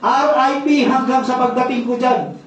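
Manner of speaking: laughing through the punchline. Retold or imitated. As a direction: chuckle while quoting the widow. Retelling punchline while laughing. (tumatawa habang sinasabi)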